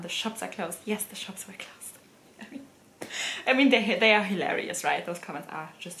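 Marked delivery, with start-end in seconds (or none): 0.8-2.7 hushed, wry whispered reply. Shown as a demonstration. Vlogger whispers her answer to a doubting commenter. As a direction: whisper it as if confiding the obvious.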